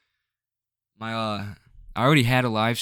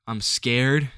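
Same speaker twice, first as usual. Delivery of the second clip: ordinary delivery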